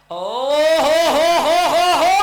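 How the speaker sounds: loud, high wicket call; excited shout. Real, not acted. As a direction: yell it as the wicket falls. Wicket falls, commentator erupts.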